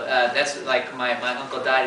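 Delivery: usual speaking voice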